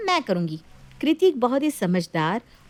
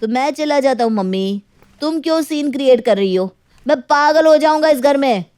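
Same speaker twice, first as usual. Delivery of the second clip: fed-up outburst; 4 semitones higher, 6 dB louder than usual. Acted. Son fed up with scene.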